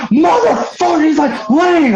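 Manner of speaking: mock outrage. Storyteller mimics Billy finding his drink gone, exasperated. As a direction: wail it like a man just robbed of his drink. Acted quote of angry Billy.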